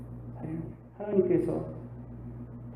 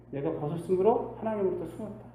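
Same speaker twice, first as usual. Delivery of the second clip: normal talking voice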